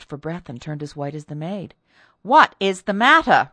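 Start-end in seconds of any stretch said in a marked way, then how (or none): 2.2-3.5 loud, emphatic repeated demand; insistent repeat. A narrator's reading. Mistress repeats her question to the speechless maid, loud and insistent. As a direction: press the question, much louder. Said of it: "repeated Missus Townsend".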